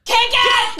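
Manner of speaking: high shouted cheer. Real, not acted. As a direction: hype it up, shouting. Shouted catchphrase.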